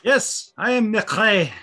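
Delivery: slow attempt at a Scottish accent. Shown as a demonstration. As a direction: say your name with a Scottish lilt. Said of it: "It needs more phlegm".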